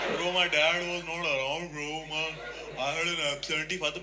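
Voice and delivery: slow, drawn-out mock whine; whining rich kid. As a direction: imitate a self-pitying rich kid. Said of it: "ये रोते रहते हैं पूरे time". (अमीर बच्चे का नकली रोना-धोना)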